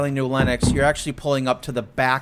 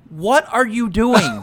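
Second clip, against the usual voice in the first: slow, higher, half-laughing challenge; confronting; 5 semitones higher, slower than usual. Retold or imitated. Confronting the driver blocking the lot, slow incredulous challenge. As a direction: drag out "what are you doing" in disbelief. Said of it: "I go up to him and I go".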